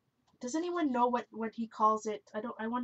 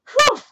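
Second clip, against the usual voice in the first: relieved whew; 16 dB louder than usual. Real, not acted. Relieved after marker test.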